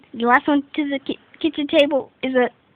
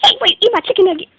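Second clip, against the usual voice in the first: angry; 8 semitones higher, 5 dB louder, faster than usual. Acted. Upset about eaten nuggets.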